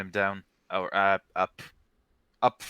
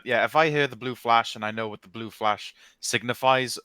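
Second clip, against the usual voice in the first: usual speaking voice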